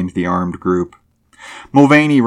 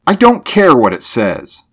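higher, louder defiant retort; thrusting retort; 5 semitones higher, 5 dB louder than usual. A narrator's reading. Driver brushes off the sheriff's sign, thrusting retort. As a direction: push back with mild heat. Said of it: "Mulvaney thrust at him".

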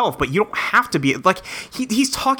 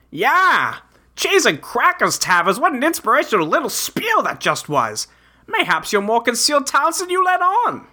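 lightly voiced quirky adventurer; a hint of folksy bravado; Jake character voice; 8 semitones higher than usual. Reads Jake's lines in role.

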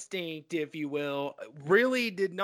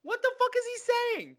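much higher, fast, incredulous squeal; baffled outcry; faster than usual. Real, not acted. To his co-host after mimicking Sheen, a high baffled squeal. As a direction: act totally lost at what you heard.

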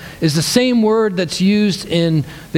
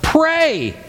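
sudden high cry of pray; the ship's captain yelling down the hatch; high, urgent command to a sleeping man; 11 semitones higher than usual. Retold or imitated. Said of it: "as the captain's crying out".

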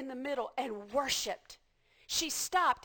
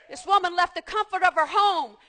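normal talking voice

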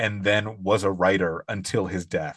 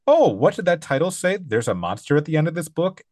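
high, excited Grover voice; worried furry monster reading the title; 8 semitones higher, 4 dB louder than usual. Retold or imitated. Podcast host quoting Grover to his co-host, high and anxious.

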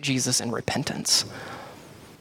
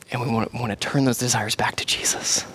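whisper. Real, not acted. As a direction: lean in and whisper it. Hushed point.

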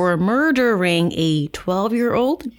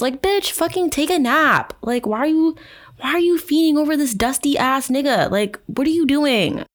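high-pitched, incredulous telling-off; fed-up, pitched-up disbelief; exasperated scolding; 8 semitones higher than usual. Real, not acted. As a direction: scold her as if she were here. Scolding the absent author.

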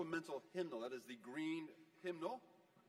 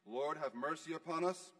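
liturgical intoning; 7 dB louder than usual. Acted. Priest leads the Kyrie.

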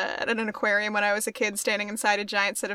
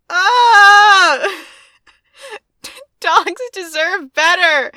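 mock outrage; 6 semitones higher, 10 dB louder, much slower than usual. Mock outrage over dogs.

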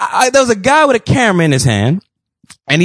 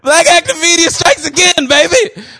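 hype shout-out; 12 semitones higher than usual. Real, not acted. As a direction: cheer the cohost on. Hyping cohost's trivia knowledge.

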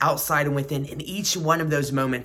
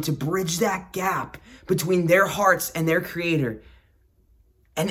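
usual speaking voice

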